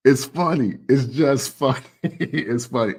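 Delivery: amused laughter through the words; laughing. Real, not acted. Laughing at [name]'s diss.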